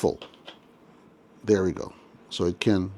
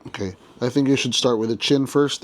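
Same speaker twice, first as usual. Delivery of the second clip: normal talking voice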